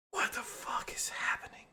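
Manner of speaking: slow, hushed whisper; drawn-out whisper under the breath; whispered reaction. Acted. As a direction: whisper it in disbelief. Reacting to falling through space.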